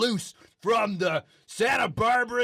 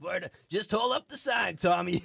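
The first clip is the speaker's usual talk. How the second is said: ordinary talk, nothing marked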